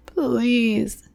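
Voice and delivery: high sobbed please; sobbing. Acted. Brett sobbing please to his tormentors. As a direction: sob the word out. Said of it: "he sobbed".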